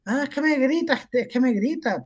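high mock whine to her mother; esposa quejándose. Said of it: "llamó hasta la madre diciéndole". (queja aguda de esposa a su madre)

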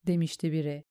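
plain reading voice

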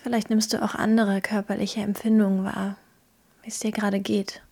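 calm, gentle guiding through a visualisation; guided meditation. Acted.

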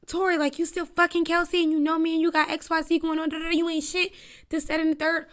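put-on high-pitched accusing rant at a boyfriend. [name] ranting at Tory in the car, high accusing voice.

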